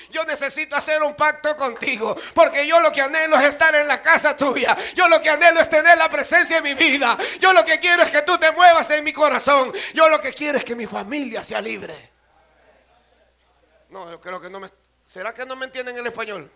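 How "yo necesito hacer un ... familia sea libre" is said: loud, high, insistent plea to God; full-force preaching. Acted. Preacher voicing David's petition to God, full-force preaching. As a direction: drive each repeated phrase out harder, easing at the end.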